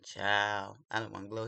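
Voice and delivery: ordinary talk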